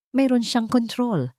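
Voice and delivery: ordinary delivery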